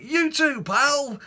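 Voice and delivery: very high, loud, gushing reply; frenzied cheer. Retold or imitated. Answering the courier, in gushing cheer. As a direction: outdo his enthusiasm. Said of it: "I was like".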